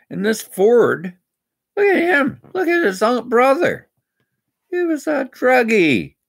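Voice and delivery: high, loud, heated ranting; angry rant. Real, not acted. Ranting at police about drunk driving, loud and heated. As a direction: let the outrage rip, pitch climbing. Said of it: "And I'm ranting".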